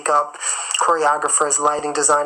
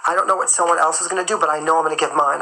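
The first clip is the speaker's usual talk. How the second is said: usual speaking voice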